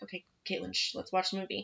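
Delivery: quiet hushing voice, well below normal level; hushed theater whisper to the stepdaughter; hushed aside. Retold or imitated. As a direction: shush her as if sitting in a dark theater.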